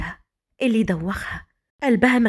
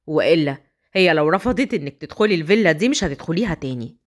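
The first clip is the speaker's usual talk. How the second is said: low, firm husband dismissing his cousin; stern husband; 7 semitones lower than usual. Acted. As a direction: lower voice, cold and commanding. Husband sends Hend away. (صوت زوج حازم وبارد)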